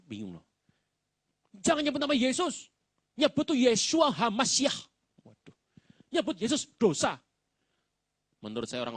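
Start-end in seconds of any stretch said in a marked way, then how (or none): 1.6-7.2 high, loud preachy scold; mocking a pedant. Mocking those who forbid saying Yesus, loud and high. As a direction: parody the name police, loud and shrill-high.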